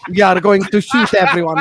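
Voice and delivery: villainous Russian accent, hammed up; Makarov from Modern Warfare. Mocking a friend's impression of him, Russian accent. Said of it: "doing a Russian accent like I'm Makarov".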